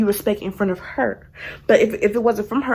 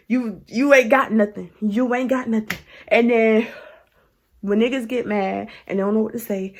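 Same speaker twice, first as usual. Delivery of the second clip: normal talking voice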